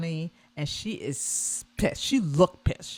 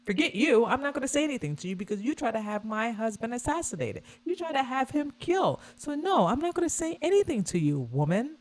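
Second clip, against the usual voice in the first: higher and faster, lightly voiced indignant brush-off; retold angry retort; 8 semitones higher than usual. Kamala imagined snapping at Trump, mock indignant and fast. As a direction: snap back as if refusing to greet her, a touch hammed-up. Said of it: "she's like".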